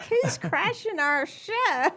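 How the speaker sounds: high, slow, laughing question. Retold or imitated. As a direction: laugh through the words. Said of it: "was like".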